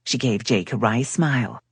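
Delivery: usual speaking voice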